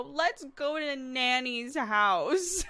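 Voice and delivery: high, drawn-out mock whine; mad toddler. Toddler mad at mum demanding Nanny's house, whiny. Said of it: "when she gets mad at me".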